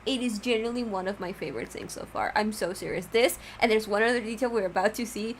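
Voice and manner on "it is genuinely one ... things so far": ordinary delivery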